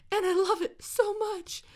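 very high squealing coo. Real, not acted. Gamer coos at a cute new puppy, squealing baby talk.

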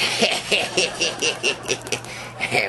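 high-pitched cackle at his own joke. Real, not acted. Comic cracking up at his own joke, high cackle. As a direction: crack up at your own punchline.

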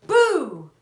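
loud boo, falling away; mock scare for kids; playful shout. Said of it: "he shouted".

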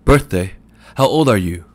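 loud incredulous bark; scoffing disbelief. A narrator's reading. Shopman scoffs at Mooney's birthday, loud and incredulous. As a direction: doubt every word he says.

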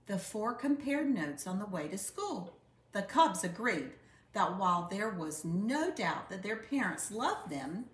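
ordinary delivery, nothing marked

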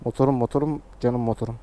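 soft, low loving voice; cooing to a beloved motorbike; tender. Real, not acted. Loving words for his motorbike. (motoruna şefkatle fısıldar gibi)

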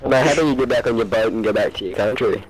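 normal talking voice

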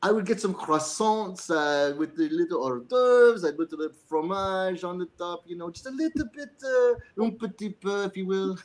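put-on a silly French accent for the interviewer. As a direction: put on a playful Montreal French accent with a few French words. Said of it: "Why don't you give us one of those accents".

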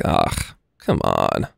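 very low, deflated imitation of giving up; dejected groan. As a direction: groan it softly, as if all is lost. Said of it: "just get me so down".

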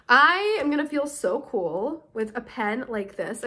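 ordinary talk, nothing marked

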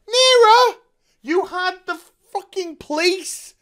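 Nearer? yelled high and loud; incredulous shout. Real, not acted. Reviewer mocking the hospital excuse, incredulous yell. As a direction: scoff at the excuse at full volume.

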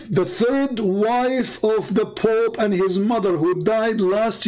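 high, thrilled admin crying out the good news; excited admin. Retold or imitated. Admin on the microphone celebrating the conversion, high and elated. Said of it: "The admin ... he said".

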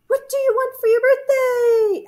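very high, held echo voice; echoing moon. A narrator's reading. Moon echoes bear's question, very high echo voice. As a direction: throw the question back like an echo. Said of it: "asked the moon".

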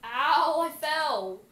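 loud cry after a fall; tripping and falling. Pretend. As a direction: yelp as you fall over. Giraffe falls in game.

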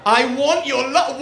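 loud, high mock-desperate cry; desperate plea. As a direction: beg the crowd at the top of your voice.

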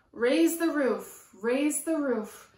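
lively sing-song picture book reading; reading to children. A narrator's reading. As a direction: chant the rhyme to a small child. Reading rhyming picture book aloud.

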